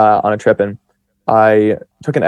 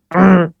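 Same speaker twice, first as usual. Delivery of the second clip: a short, higher, louder "mm" of approval; appreciative hum; 6 semitones higher, 3 dB louder than usual. Retold or imitated. To his best friend, answering how muscular: an approving hum. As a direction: answer with one knowing, relishing hum. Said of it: "but with a sound".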